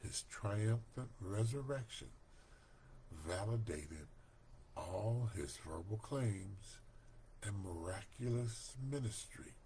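usual speaking voice

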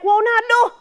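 loud, high-pitched teacher's intervention. Retold or imitated. Teacher running over to stop boys beating someone, shouting. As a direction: call out sharply to stop them, loud and high. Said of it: "put on all my teacher voice now".

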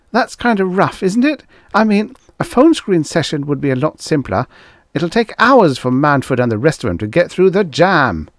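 high-pitched, anxious underling; protesting assistant. A narrator's reading. Assistant objects to the producer's plan, high-voiced protest.

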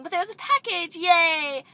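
delighted squeal of yay; excited. Real, not acted. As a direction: cheer the surprise. Unboxing a surprise package.

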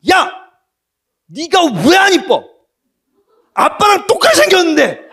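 high, loud mock-angry yell at the daughter. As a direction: yell it out, half laughing at the end. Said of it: "화가 나갖고 소리를 빽 질렀어요". (딸에게 웃음 섞인 장난스러운 고함)

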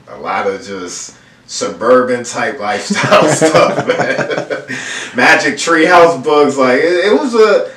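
laughing through the words; a light laugh while remembering. Real, not acted. Laughing while recalling suburban childhood.

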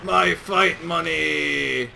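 loud, slow, drawn-out fighting game shout; Balrog. Retold or imitated. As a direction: bellow it like a boxer taunting. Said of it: "Balrog says".

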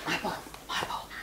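whispered dog voice; mock possessive pup voice; voicing the dog. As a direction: speak for the dog, hushed and quick. Owner voices dog's thoughts.

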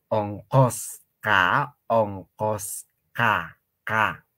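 "oncosca" stretched out slowly, syllable by syllable; slow drawn out. Shown as a demonstration. Teacher drills pupils on Quechua Q, slowly drawn out. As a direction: drag out each sound of "oncosca". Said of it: "la Q en el quechua se pronuncia".

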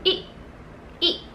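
I clipped short like a dot, neutral tone. Shown as a demonstration. Teacher showing the quick dot-like fifth tone. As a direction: say I quick and tiny. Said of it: "parang tuldok lang".

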